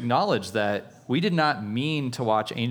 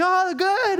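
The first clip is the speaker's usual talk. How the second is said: very high, louder delighted squeal; giddy servant girl; 5 dB louder than usual. Retold or imitated. Servant girl delighted to hear Peter, high squeal. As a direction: squeal with joy and dash off. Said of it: "she's like".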